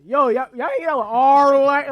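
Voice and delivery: hammed-up dumb-sounding holler; mock dumb guy. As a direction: blurt it like a goofy kid, stretching the name. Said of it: "you just sound dumb saying it".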